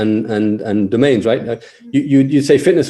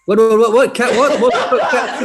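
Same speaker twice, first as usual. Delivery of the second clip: baffled; 10 semitones higher than usual. Real, not acted. Misheard question, baffled.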